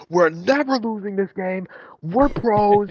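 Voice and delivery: high, drawn-out mock team bravado; hammed-up insistence, pitched well up; mock overconfidence. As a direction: stretch it out high like a cocky player. Acting out overconfident team at monitors.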